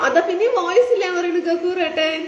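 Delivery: high-pitched, breaking into a laugh; real laugh creeping into a high voice; amused complaint. Real, not acted. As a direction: report it in amused disbelief, laughing at the end. Amused report of sound complaint.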